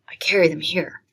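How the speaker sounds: secretive whisper. Acted. Cousin Jimmy confides his poems to Emily in a whisper. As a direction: breathe it like a secret.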